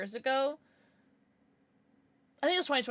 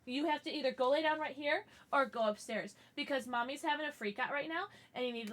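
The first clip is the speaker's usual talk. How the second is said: ordinary delivery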